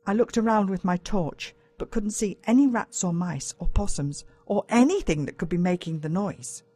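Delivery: ordinary delivery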